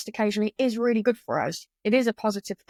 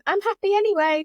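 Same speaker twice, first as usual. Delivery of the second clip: much higher, sing-song fake happiness; mock forced cheer; 11 semitones higher, 3 dB louder than usual. Mocking toxic positivity to the host, fake bright cheer. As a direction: chirp it like a false smile. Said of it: "blinkers on".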